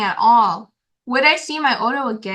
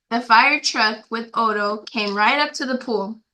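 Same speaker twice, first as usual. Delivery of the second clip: plain reading voice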